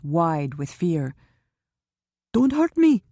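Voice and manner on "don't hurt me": high, loud frantic pleading. A narrator's reading. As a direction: beg desperately for your life, much higher. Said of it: "he said frantically".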